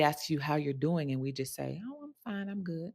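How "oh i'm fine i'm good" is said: high, hushed "Oh, I'm fine"; mimicking the throwaway "I'm fine"; airy automatic reply. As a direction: toss it off lightly, barely there.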